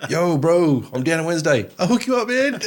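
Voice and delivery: high, excited bro greeting; casual bro voice. Retold or imitated. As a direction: hype it up like a mate. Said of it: "I'm like".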